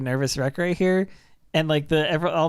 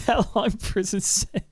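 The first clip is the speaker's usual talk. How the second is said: laughing; 3 semitones higher than usual. Real, not acted. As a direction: let the laugh spill over. Restarts after laughing.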